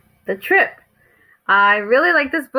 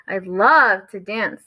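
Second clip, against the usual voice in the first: love held long and punched; stress on love; slower than usual. A narrator's reading. Froggy hears about the dance, heavy emphasis on love. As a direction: give love extra power, stretched out. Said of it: "with more emphasis in our voice".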